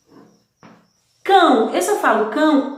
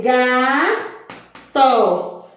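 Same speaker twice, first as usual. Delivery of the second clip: "gato" slowly as ga... to, with a gap; split into syllables. Shown as a demonstration. Teacher showing gato has two, syllable by syllable. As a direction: stretch "gato" into two separate beats. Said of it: "eu tenho duas".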